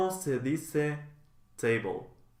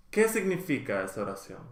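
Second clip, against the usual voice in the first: fast, run together like a native; natural fast speed; 3 semitones lower, faster than usual. Shown as a demonstration. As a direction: rattle it off at real conversational speed. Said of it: "teacher models phrase twice".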